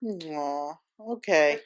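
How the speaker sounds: soft, tender bedtime coo; gently coaxing someone off to sleep; pillow talk. Real, not acted.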